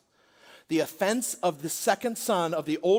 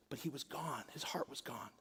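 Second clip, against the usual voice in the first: dramatic whisper; 4 semitones lower, 15 dB quieter than usual. Real, not acted. Dramatic point in sermon.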